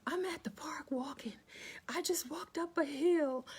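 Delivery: high whispered apology, winded; breathless apology. Retold or imitated. Apologizing to her live viewers while out of breath on a walk, high whisper. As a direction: gasp out a sorry after the hill. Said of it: "And I'm like".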